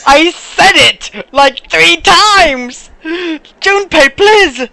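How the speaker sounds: slow, drawn-out exasperated plea; high and pleading, drawn out. Real, not acted. Exasperated with the game character.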